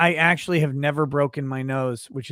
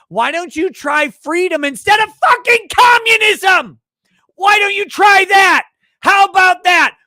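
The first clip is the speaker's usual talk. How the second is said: screaming at the top of the lungs, much higher and louder; furious shout; 11 dB louder than usual. Acted. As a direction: yell it like a ranting madman. Mock rant peaks.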